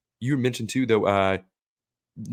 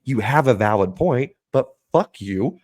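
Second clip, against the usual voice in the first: mock grudging retort; 4 semitones higher, 5 dB louder, much slower than usual.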